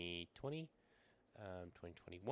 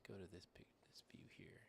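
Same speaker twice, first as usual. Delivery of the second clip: whispering; 13 dB quieter than usual. Real, not acted. Aside while switching camera views.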